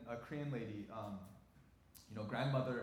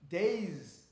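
usual speaking voice

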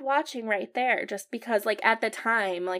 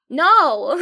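loud, high, outraged "no"; emphatic protest; 5 semitones higher, 13 dB louder than usual. Retold or imitated. Answering people who loved the painful film, loud protest. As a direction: blurt out an indignant refusal.

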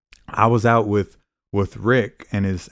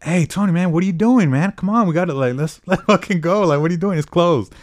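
calling to a friend; 7 semitones higher, 3 dB louder than usual. Retold or imitated.

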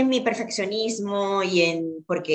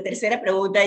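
ordinary talk, nothing marked

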